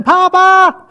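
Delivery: high, loud insistent call. Retold or imitated. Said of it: "if you're not paying attention".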